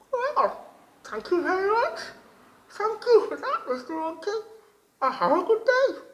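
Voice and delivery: high, loud, bright-eyed little frog voice; wide-mouthed frog voice. A narrator's reading. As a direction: squeak it out like an eager little frog. Frog thanks the monkey.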